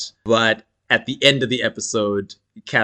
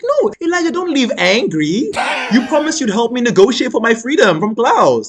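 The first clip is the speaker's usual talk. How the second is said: whiny pleading; 11 semitones higher, 4 dB louder than usual. Retold or imitated. Catherine pleading Elijah to stay.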